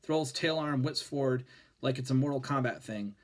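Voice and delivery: plain reading voice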